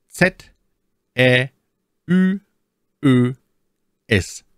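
reciting the alphabet letter by letter; each letter name said slowly and clearly with pauses; spelling it out. Shown as a demonstration. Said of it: "Wie buchstabiert man das jetzt?".

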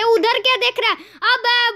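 high, irritated shout; exasperated scolding. Real, not acted. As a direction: scold him for looking away, pitched high. Scolds character as he falls. (झल्लाकर डाँटना)

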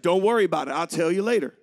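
loud, low dismissive mom; mom brushing it off. Retold or imitated. Mom puts off the children's question, loud and dismissive. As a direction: wave the kids off loudly. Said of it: "And she'd be like".

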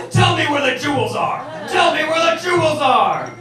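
loud, high bad-cop shouting; mock interrogation yell. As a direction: bark it like a movie cop. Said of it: "throw the coffee in her face".

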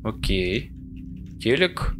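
usual speaking voice

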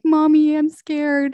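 put-on whimpering kid wanting to be held; imitating a frightened child crying for mommy; scared little kid. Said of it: "like a scared little kid".

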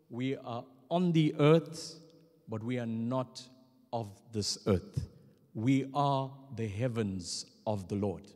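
ordinary delivery

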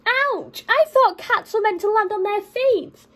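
hammed-up ouch; hurt cat's yelp. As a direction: yelp and complain as a squashed creature. Cat lands on dog.

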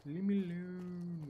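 slow, stretched, at normal volume. Real, not acted. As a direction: drag out "Leave me alone" without pushing it. Repeats it more quietly.

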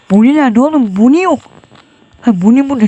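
normal talking voice

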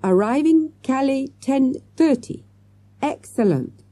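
put-on high-pitched, halting French-accented English. A narrator's reading. Waiter giving travel times by phone, halting French-accented English. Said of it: "speaking in halting English".

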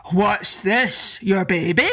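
slow, lightly voiced giant agreeing; a hint of a lumbering giant; giant's voice. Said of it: "Ben and Donner asked".

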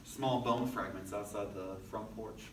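usual speaking voice

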